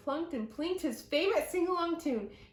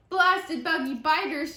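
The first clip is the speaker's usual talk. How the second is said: louder cursing at the swarm; angry shout; 4 semitones higher, 6 dB louder than usual. Acted. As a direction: Uncle Tex swears at bugs. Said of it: "shouted Uncle Tex".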